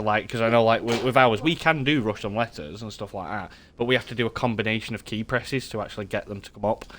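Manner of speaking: ordinary talk, nothing marked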